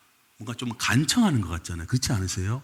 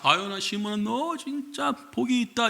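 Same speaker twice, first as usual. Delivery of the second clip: delighted praise; 8 semitones higher than usual. Retold or imitated. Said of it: "예수님도 좋으셔서".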